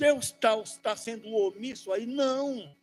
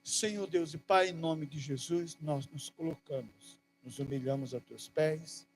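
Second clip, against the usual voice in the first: fervent prayer; 5 semitones lower than usual. Acted. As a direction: pray humbly to God on behalf of the church. Leads a prayer over music. (oração fervorosa e íntima)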